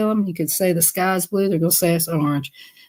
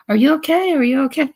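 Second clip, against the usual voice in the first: worried; 7 semitones higher, faster than usual. Retold or imitated. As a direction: call up anxiously. Alarmed at sister's screams.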